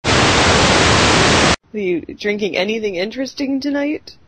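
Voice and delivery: usual speaking voice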